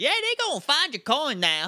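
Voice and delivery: high-pitched cartoon Southern yell; exaggerated Southern accent. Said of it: "where in the South this guy came from".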